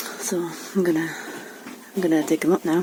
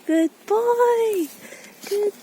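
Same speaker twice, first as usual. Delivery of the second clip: very high, sing-out praise to a pet; real delighted pet-talk, pitched way up; 14 semitones higher, much slower than usual. Real, not acted. Praising Casper for dropping it.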